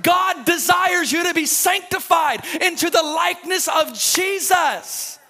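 loud, high, driving sermon climb; full-force preaching. Acted. Pastor preaching to the congregation about God's reality, loud and driving. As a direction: preach it hard to the crowd, building.

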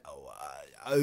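mock hesitant murmuring, soft; awkward listening noises. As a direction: stall with uncomfortable little uhs. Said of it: "we're just listening like".

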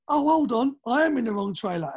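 retold moment of realizing; sudden realization. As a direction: act out the penny dropping. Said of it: "and then he realizes".

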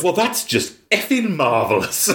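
high-pitched, mock-exasperated retort; sarcastic exasperation. Acting out the thorn-covered character's reaction, mock exasperation. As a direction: imitate his sarcastic, put-upon complaint. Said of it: "he's just like".

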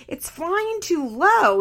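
high, loud, drawn-out imitation of worry; wailed retold warning; mock alarm. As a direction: act out someone fretting, stretching the words. Retelling panic over low plane.

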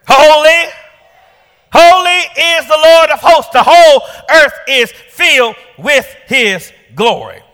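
high ringing cry of holy, holy; soaring shouted proclamation; shouted praise. Retold or imitated. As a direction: call it out across to another angel. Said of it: "who shouts to an angel over there".

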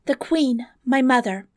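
high-pitched earnest princess. Acted. Princess confides in the monster about her mother, high voice. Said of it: "replied the princess".

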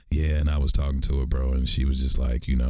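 very low, monotone mock-sexy host; podcast dude voice. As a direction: drop deep and drone like a smooth podcaster. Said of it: "dudes talking like this the whole time".

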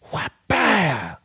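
high, punchy mouth-made whack; vocal whap-bah impact noise; impact sound effect. Retold or imitated. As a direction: slap out the hit like a cartoon smack. Imitating clothesline impact.

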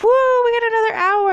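high, fast, loud giddy cheer; excited whoop. Retold or imitated. Two friends in the car cheering the extra hour, high and fast. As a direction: cheer like you just won time. Excited about time reset.

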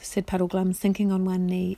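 ordinary delivery

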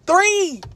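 Three! shouted high and hard; emphatic exclaim. Real, not acted. Telling viewers how many managers were on shift, emphatic. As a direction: punch the word Three! in disbelief. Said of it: "emphatic exclaim".

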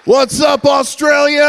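big hyped-up shout to the crowd; crowd greeting shout. Real, not acted. Preacher hyping up an Australian church crowd, loud shout. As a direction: shout it out to the whole room.

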